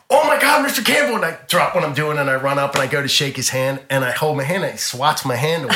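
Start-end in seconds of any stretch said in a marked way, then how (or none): 0.1-1.2 high, loud excited gush; excited cry, pitched way up; starstruck fan. Retold or imitated. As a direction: re-enact a thrilled fan meeting the idol. Acted starstruck fan greeting idol.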